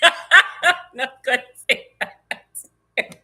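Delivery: laughing it out, slowed right down; laughing repeat of a line she won't say. Real, not acted.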